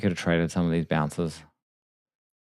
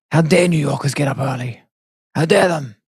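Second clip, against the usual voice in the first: higher, louder mock indignation; hammed-up outraged complaint; mock outrage; 7 semitones higher, 6 dB louder than usual. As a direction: play scandalised, raised in pitch and volume. Mock outrage at early New Yorkers.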